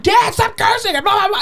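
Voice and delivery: high-pitched imitation of scolding daughters; shrieky telling-off of Dad, imitated; mock yelled scolding. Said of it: "yelling at this".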